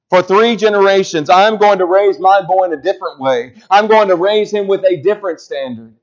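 loud, high, driving preacher's call; preaching at full force. Acted. Pastor urging the church to break family patterns, preaching loud. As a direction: drive it home to the congregation.

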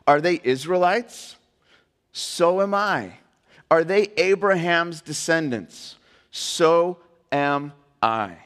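slow questions, each answered with weight; spaced-out, emphatic call and answer; rhetorical scripture reading. Acted. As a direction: lift the question, then land the answer deliberately. Reads Paul's rhetorical questions and answers.

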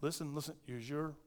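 hushed, soothing mama; calm a crying child softly. Retold or imitated. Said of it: "and they'll go".

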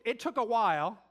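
normal talking voice

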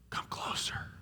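intimate, breath-only whisper; whispered invitation. Acted. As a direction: beckon them close in a whisper.

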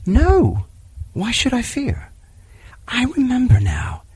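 put-on high, eager boy's voice; a fearless wild boy remembering; young boy voice. Mowgli answers Bagheera.

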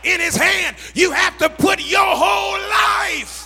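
rhythmic shouted list, climbing in pitch; full-force preaching. Real, not acted. Preacher telling congregation to surrender everything, building sermon shout.

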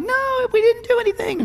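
very high-pitched, tiny pleading voice; squealed protest. Retold or imitated. Dad mimicking a protest at the sandcastle, high squealed whine.

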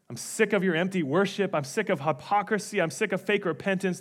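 normal talking voice

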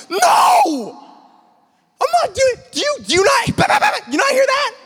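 high loud panicked shouting; shouting no from cover; panicked refusal. Retold or imitated. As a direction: yell back at friends in a panic. Refusing friends, panicked under fire.

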